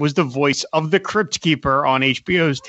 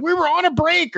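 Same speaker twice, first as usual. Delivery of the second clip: high whiny Ross from Friends; Ross impression; 14 semitones higher than usual. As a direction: protest like indignant Ross. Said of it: "he was gonna be like".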